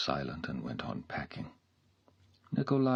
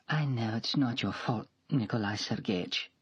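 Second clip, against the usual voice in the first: put-on higher, whispered in places, an offended young woman; hurt young maid; 6 semitones higher than usual. A narrator's reading. Mashenka voiced, tearful.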